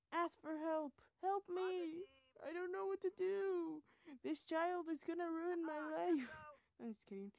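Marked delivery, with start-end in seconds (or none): 0.1-6.3 loud, high, drawn-out wail for help; hammed-up panicked parent begging for rescue; mock desperation. Gamer playing an overwhelmed parent for viewers, mock desperate. Mock desperate plea, then says just kidding.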